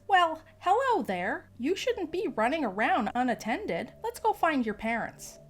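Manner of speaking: syrupy grandma, falling into concern; sweet old lady. Acted. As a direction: talk down to a small child sweetly. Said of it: "The lady looks down and says".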